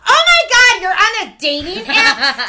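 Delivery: high, loud laughing disbelief; sister screaming. Retold or imitated. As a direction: shriek it through a laugh. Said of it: "screaming at each other in the bathroom".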